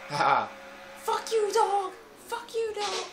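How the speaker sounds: high, squealing victory taunt at the dog; gloating taunt. Retold or imitated. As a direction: shriek it like a kid who just escaped. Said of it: "and I'm like".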